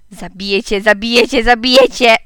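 repeated cry growing to loud; building shout. A narrator's reading. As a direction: start normal, climb to a shout. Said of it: "krzyknęła". (narastający krzyk)

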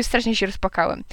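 plain reading voice

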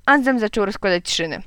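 ordinary delivery

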